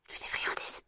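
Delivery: chuchote au chat; chuchoté. Real, not acted. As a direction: murmure doucement pour le chat. (chuchote au chat pour lui proposer des friandises)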